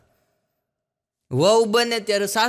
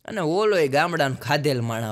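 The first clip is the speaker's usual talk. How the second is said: ordinary delivery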